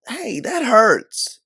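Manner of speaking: very high, loud, slow yelp of pain; friend's wrist squeezed too hard, pained shout; pained cry. Acted. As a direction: cry out as the grip hurts. Grabbed wrist hurts.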